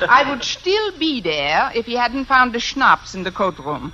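mock-German teacher's phone voice; put-on German accent. Fake applicant admitting to schnapps, put-on German accent. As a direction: confess in a stagey German accent. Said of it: "If I can do a decent German accent".

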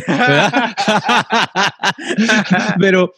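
laughing through the word "¿verdad?"; a real laugh breaking out on "¿verdad?". Real, not acted. Joking about his ashes in the bin, laughing.

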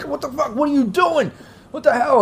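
high-pitched, half-choked outcry; choking man's protest. Acted. Game master as the man being choked, gurgling out. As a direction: squeak it out like someone being choked. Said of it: "He kinda gurgles out".

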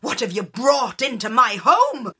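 high startled shriek, then loud; old woman startled by the changeling, shrieking. Acted. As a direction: recoil in fright from the baby. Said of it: "The old woman shrieked".

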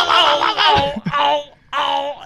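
high, loud yelps of a woman losing her footing; mock panicked cries. Imitating the news lady falling off the grape barrel, high loud cries. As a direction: wail it out high and loud as she topples. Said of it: "It's like".

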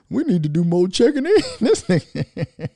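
words breaking into real laughing; talking through laughter. Real, not acted. Host cracking up at his own joke, laughing as he talks. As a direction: let laughter take over the words.

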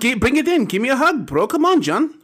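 hug-demanding hard sell; used car salesman. Pretend. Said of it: "used car salesman".

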